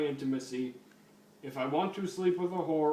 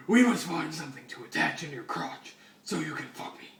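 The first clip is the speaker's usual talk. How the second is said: hushed, breathy Batman whisper to RoboCop. Acted. Batman whispers his plan to RoboCop, hushed whisper. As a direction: whisper it like a secret plan in the dark. Reading Batman's line in fanfic.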